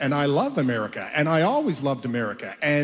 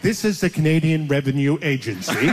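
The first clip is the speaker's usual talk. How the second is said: stiff recorded-voice scam call, laughing through it; mock automated government message; robot voice. Imitating the robocall warning him, flat and mechanical. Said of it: "a robot voice saying".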